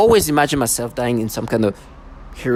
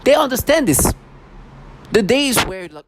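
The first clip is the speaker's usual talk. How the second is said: ordinary talk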